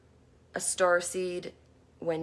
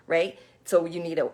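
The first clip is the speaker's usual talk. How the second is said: ordinary talk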